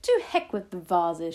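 loud, careless dismissal; shouted. A narrator's reading. Grandmother dismissing the vases, shouting. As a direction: shout the worry away. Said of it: "she shouted".

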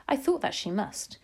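plain reading voice

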